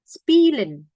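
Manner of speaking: "Spielen" starting with hissed S; ordinary S. Shown as a demonstration. Teacher giving S-before-consonant example, unvoiced S onset. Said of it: "bacanya kayak S biasa".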